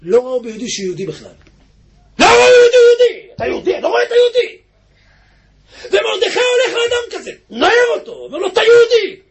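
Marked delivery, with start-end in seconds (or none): 2.2-3.3 loud, high outcry, leaning on ביהודי; preaching outcry. Acted. Preacher thunders the point at the congregation, loud outcry. 3.3-4.6 fast, anguished shout; impassioned shout. Acted. 8.5-9.2 loud high call; waking shout. Acted.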